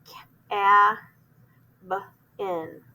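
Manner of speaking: "Ca-, a, b, in" split into separate sounds, each one pulled apart; sounding out a word. Shown as a demonstration. Teacher sounding out a word for students, sound by sound. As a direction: stretch out "Ca-, a, b, in" sound by sound. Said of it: "write a vowel".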